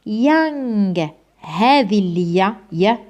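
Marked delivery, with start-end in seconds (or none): 0.1-1.1 يانغ stretched very slowly, y start and ringing ng end; pronunciation demo. Shown as a demonstration. Teacher repeats the word for students, very slow pronunciation demo. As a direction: draw يانغ right out. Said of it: "بصح شوف كيفاش راح ننطقها".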